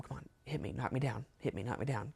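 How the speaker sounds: softly voiced, discreet goading; hushed urging, not a true whisper; retold whisper. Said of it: "I started whispering to him".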